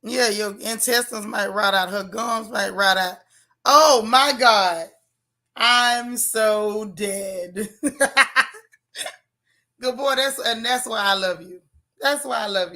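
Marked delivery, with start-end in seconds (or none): 3.6-4.9 drawn-out, much louder outburst; loud amused exclamation. Real, not acted. Host reacting to a chat joke, loud drawn-out disbelief. 5.5-7.7 very slow, stretched words breaking into laughter; cracking up. Real, not acted. Host floored by a chat joke, drawn-out laughing. 7.7-8.6 louder, higher burst of laughing; real laughter. Real, not acted. Host floored by a chat joke, bursting out laughing.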